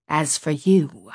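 slow, pointed, stern address; disgusted scolding. A narrator's reading. Woman points at girl, lost for words, disgusted. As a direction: draw it out accusingly. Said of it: "so disappointed and disgusted with me".

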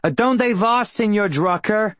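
put-on Spanish teacher calling out in a thick New Jersey accent; heavy New Jersey accent. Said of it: "calling out to me in her heavy New Jersey accent".

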